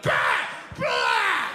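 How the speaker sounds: high, loud mocking blah; a hint of mockery. Mocking empty chatter.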